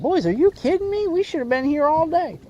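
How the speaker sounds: put-on high, incredulous call to the boys; excited. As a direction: replay the thrill of a near catch. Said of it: "I was like".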